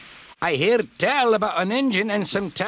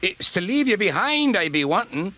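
high-pitched cross grumbling; angry; 11 semitones higher than usual. A narrator's reading. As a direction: let the irritation push your voice up. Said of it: "was angry".

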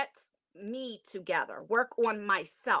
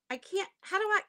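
ordinary talk, nothing marked

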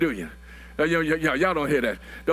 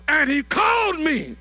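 preaching at full force; 8 semitones higher than usual. Acted. Pastor testifying to the congregation about his calling, shouting. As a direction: shout out the altar-call testimony.

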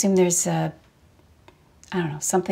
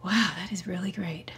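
whispering; 7 dB quieter than usual. Acted. Quiet aside to husband.

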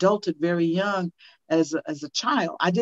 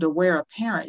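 ordinary delivery

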